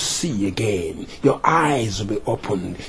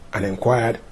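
usual speaking voice

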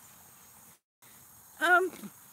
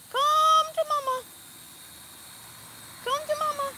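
coaxing call; 6 semitones higher than usual. Real, not acted. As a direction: coax it home like a pet. Coaxing the drone back.

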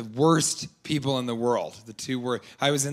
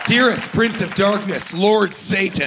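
high, loud fervent invocation building up; mock satanic prayer; 7 semitones higher, 4 dB louder than usual. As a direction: pray zealously, climbing into a whiny "daddy".

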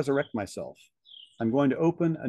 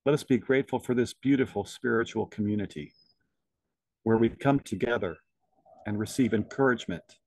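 guided meditation. Acted. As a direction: lead them gently inward, unhurried. Leads a meditation.

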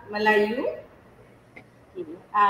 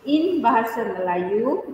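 usual speaking voice

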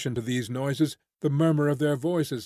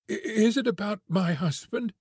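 halting, voice pitched up in alarm; stammering; 7 semitones higher than usual. A narrator's reading. Tess asking Marian about her husband, stammering. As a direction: stumble over the first word. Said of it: "she stammered".

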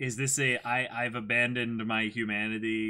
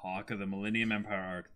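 normal talking voice

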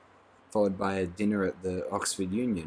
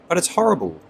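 higher, louder frightened protest; 10 semitones higher, 10 dB louder than usual. A narrator's reading. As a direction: recoil from the sight in fear. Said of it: "shrinking back from the window".

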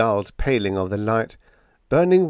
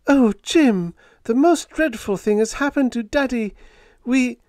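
put-on high, louder young woman's voice in distress; Helen's distressed voice; 5 dB louder than usual. A narrator's reading. Helen telling Jim about her father, high and distressed.

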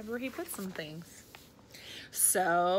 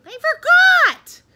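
high, loud, drawn-out cry; childish wail; 20 dB louder than usual. A narrator's reading. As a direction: wail the excuse like a little kid. Froggy wailing his excuse.

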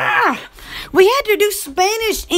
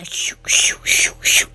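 whispered shoo shoo like rushing air; shooing hiss; slower than usual. Retold or imitated. Imitating the washroom's noises for viewers, airy whispered shoos.

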